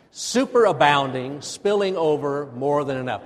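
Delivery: loud, measured call to repeat; rousing recitation. Shown as a demonstration. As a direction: wake the congregation up with each phrase. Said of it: "Oh, say it again. Wake up".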